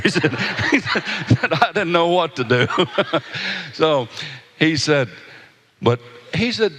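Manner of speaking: laughing through the words; real laugh while telling. Real, not acted. Preacher laughing at the groom's story, laughing.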